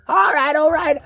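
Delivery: high, breathless sprite; fairy voice. Pretend. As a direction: play a little magical sprite. Sprite O'Wright voice.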